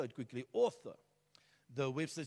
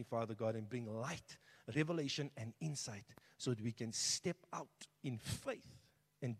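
prayer; 3 semitones lower, 5 dB quieter than usual. Acted. Pastor prays before sermon.

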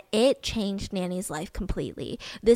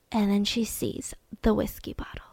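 hushed whisper; 7 dB quieter than usual. Real, not acted. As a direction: drop to a whisper for the reveal. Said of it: "storyteller drops to a whisper".

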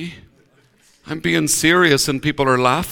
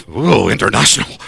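mock amazement; 8 semitones lower than usual. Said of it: "I nearly felt myself going".

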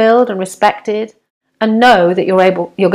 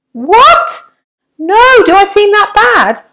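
shocked; 12 semitones higher, 4 dB louder, slower than usual. Acted. Shocked at nurse's question.

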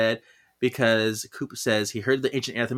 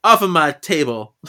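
loud, higher mock scolding; shooing cats; 4 semitones higher, 8 dB louder than usual. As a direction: stumble loudly through an acted order to pets. Acting Phoebe shooing cats away.

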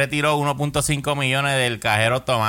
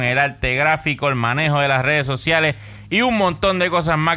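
voz de comercial de radio; lectura de anuncio; 4 semitones higher than usual. Acted. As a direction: vende como locutor de radio. Lee el anuncio del patrocinador. (anuncio publicitario)

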